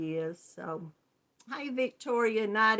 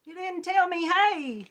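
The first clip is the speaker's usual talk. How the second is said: high, playful pretend scold; mock viewer complaint; 9 semitones higher, 3 dB louder than usual. Host voicing a viewer who was missed, high playful complaint. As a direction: pretend to be a left-out viewer, teasing. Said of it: "come back and say".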